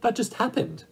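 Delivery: put-on high-pitched little girl; simple, artless reply. A narrator's reading. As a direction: pitch it up high, an innocent child stating it simply. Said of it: "returned Dorothy simply".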